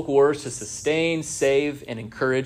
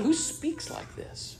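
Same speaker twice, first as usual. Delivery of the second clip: awed whisper; 14 semitones higher, 11 dB quieter, slower than usual. Retold or imitated. Amazed disciples quoted.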